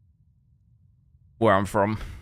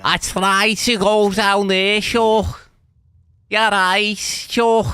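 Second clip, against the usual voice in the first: high, drawn-out mock Scouse; Scouse accent; 9 semitones higher, 3 dB louder, much slower than usual. Shown as a demonstration. Streamer showing viewers how Scouse sounds, exaggerated Scouse accent. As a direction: put on a hammed-up Scouse accent. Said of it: "If I was Scouse, I'd sound like".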